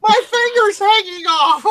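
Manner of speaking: high, loud, slow laughing wail; laughing shriek. Retold or imitated. Woman retelling her cut finger, laughing high and loud. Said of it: "I'm like".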